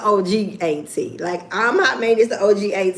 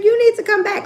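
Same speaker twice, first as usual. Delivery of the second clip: much higher, eager mock fan voice; excited fan; 13 semitones higher, 5 dB louder, faster than usual. Imitating a fan urging her to return, much higher pitched. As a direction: gush like a thrilled fan. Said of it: "She was like".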